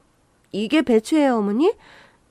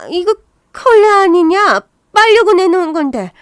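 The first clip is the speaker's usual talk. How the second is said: loud, high, indignant shout; furious high-pitched complaint; angry outburst; 6 semitones higher, 8 dB louder than usual. Acted. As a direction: yell in disgusted outrage, dragging the words out. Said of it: "성질 부리는".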